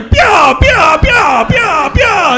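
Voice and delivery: high, loud "Pyaw" blast; comic laser pyaw. Shown as a demonstration. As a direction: fire off a cartoon zap. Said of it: "I hear this sound every time".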